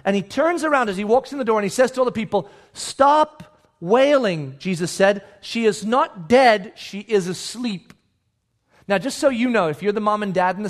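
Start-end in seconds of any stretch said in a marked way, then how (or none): 2.7-4.6 drawn-out, deliberate command; measured, very slow order. Acted. Voices Jesus addressing mourners. 5.4-7.9 deliberate, word-by-word statement; slow and weighty, easing off at the end; slow declaration. Acted. Voices Jesus's declaration to mourners.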